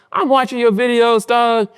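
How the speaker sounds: high, excited voice; hyped-up retelling. Retold or imitated. Telling Kevin about viral views, excited high voice. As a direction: gush about the views, pitch way up. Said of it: "I was like".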